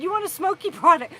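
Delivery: quoting the ranger. Retold or imitated. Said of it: "I didn't know what he meant when he said".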